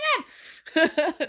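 high, loud burst of laughter; embarrassed laugh. Real, not acted. Realizing the post will live forever, a high burst of laughter. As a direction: laugh it off, mortified.

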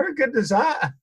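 high-pitched, thrilled exclamation; retold excited delight. Fan to brother after meeting a hero, high excited delight. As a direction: relive the thrill, pitch leaping up.

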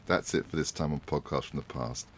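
low, unhurried host outro; podcast sign-off. Acted. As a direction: wrap the show up calmly. Host's closing sign-off.

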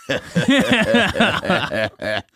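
high mock chuckle of the cop; imitated laugh. Imitating the officer's laugh at the street name, mock chuckle.

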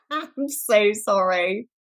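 slow, giggling sorry; embarrassed giggle after a bad accent; laughing apology. Real, not acted.

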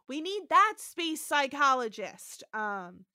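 ordinary talk, nothing marked

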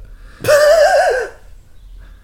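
loud squealing laugh; cackling up high at own joke; high burst of laughter. Real, not acted.

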